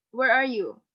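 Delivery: usual speaking voice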